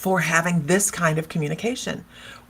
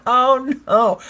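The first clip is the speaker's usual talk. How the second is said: loud mock alarm; 5 semitones higher, 8 dB louder than usual. Real, not acted. Reaction to tweakers.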